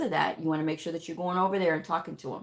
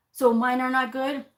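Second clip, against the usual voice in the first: higher, louder worried question; doctor suddenly insecure about their notes, pitched up; anxious provider; 7 semitones higher, 4 dB louder than usual. Retold or imitated. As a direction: play the provider fishing for reassurance.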